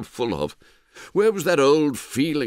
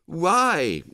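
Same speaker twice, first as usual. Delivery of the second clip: a startled, raised "Why"; surprised; 8 semitones higher than usual. A narrator's reading. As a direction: caught off guard, pitch jumps up. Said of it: "he said in a surprised voice".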